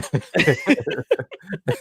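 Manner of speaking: helpless high laugh; high-pitched laugh. Real, not acted. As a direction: burst out in a high giggle. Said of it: "I can't stop laughing".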